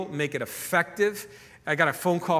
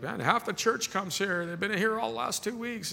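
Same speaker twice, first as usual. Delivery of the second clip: dental staff voiced higher; 6 semitones higher than usual. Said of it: "they're like".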